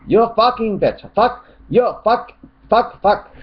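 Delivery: high shouted bursts of cursing; mock angry swearing. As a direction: spit out each curse in a high mock yell. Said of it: "what you are so angry about".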